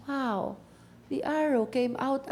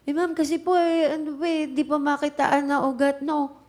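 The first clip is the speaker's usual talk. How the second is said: flustered excuse; 5 semitones higher, 4 dB louder than usual. Retold or imitated. Voices flustered lab worker making excuses.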